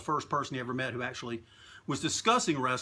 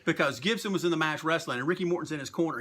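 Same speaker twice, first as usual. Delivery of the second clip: ordinary talk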